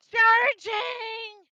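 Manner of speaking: high loud battle shout; charging yell. Acted. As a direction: yell as you rush in. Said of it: "you go".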